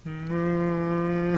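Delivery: drawn-out sorry little moo; pathetic moo. Acted. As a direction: moo pitifully like a hurt cartoon cow.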